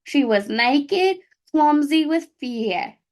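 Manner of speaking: hammed-up Midwest mom accent. Shown as a demonstration. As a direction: read it like a chatty Midwest mom, slowly. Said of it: "a Midwest mom accent".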